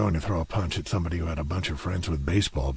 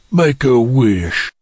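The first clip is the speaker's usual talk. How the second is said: menacing villain line; 5 semitones higher, 9 dB louder, slower than usual. Acted. Villain menaces before hurting dog.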